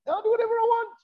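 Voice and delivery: high, sing-song stress on whatever; "whatever" lifted high and stretched, carefree; defiant son. Retold or imitated. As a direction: crow it like a kid let off the leash. Son's defiant thoughts repeated.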